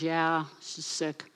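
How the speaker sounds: slow, low, disinterested mumble; a hint of a sulky king brushing it off; bored king David. David answering Satan, slow and uninterested. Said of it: "David goes".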